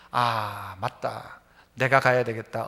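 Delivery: low, soft inner voice of sudden resolve; dawning thought, said low to yourself; quiet realization. Acted. (낮고 조용한 깨달음의 혼잣말)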